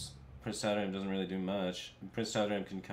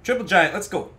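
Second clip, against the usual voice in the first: high, loud cheer; excited shout; 10 semitones higher, 14 dB louder than usual. Real, not acted. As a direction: cheer the big play. Big play celebration.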